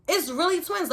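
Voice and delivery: high excited exclamation; excited shout. Retold or imitated. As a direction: shout it out in disbelief. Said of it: "kept on screaming that out".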